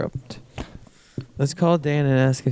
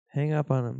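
normal talking voice

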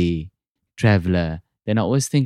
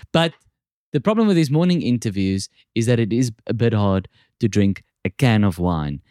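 ordinary talk

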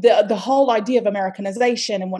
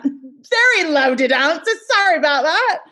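put-on high, loud, playful British accent; British accent pitched up high and loud; 7 semitones higher, 7 dB louder than usual. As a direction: stretch it out in a sing-out British accent. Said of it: "we've already started on the accent".

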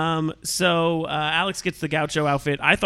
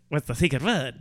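Groucho Marx impression. Shown as a demonstration. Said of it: "Do it like".

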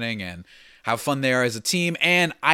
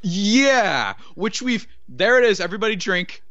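excited outburst; 9 semitones higher, 6 dB louder than usual. Real, not acted. Drinking-game joke on scandal.

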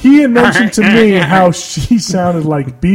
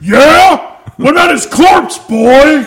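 hammed-up aggressive friend, much higher and louder; mocking friend voice; 9 semitones higher, 6 dB louder, slower than usual. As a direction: bark it like a hostile bro in a mock voice. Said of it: "And you're like".

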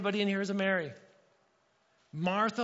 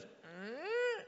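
a high voice that cracks on one syllable; voice cracking; 10 semitones higher, 5 dB quieter than usual. Shown as a demonstration. Pastor showing how his voice cracks in church, cracking high. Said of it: "and it cracks a little".

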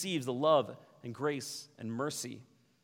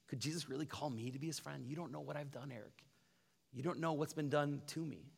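hushed doubt; 8 dB quieter than usual. Retold or imitated. Doubting listener addresses pastor.

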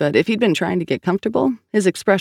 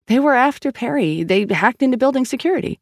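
higher, urgent insistence; pleading to be believed, pitched up; insistent; 9 semitones higher than usual. Acted. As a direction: press the theory with raised pitch. Sal urgently argues her theory.

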